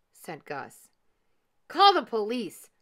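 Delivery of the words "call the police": loud, higher-pitched shout. A narrator's reading. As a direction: shout it as an urgent order. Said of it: "shouted Mister Frizzle".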